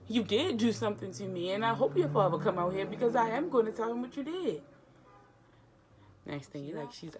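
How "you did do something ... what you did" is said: louder, higher, indignant; mock outraged retort; retold outburst. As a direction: snap back at him. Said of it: "So I'm like".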